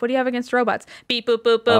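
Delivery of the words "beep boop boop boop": robot voice. Pretend. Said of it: "commit to the robot life".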